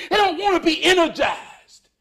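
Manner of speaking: ordinary talk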